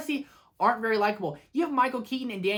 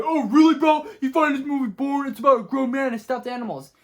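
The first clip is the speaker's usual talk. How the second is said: higher, loud mock disbelief; mock indignant objection; 5 semitones higher, 7 dB louder than usual. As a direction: object like an outraged fan, hammed-up. Acts out indignant viewer objecting.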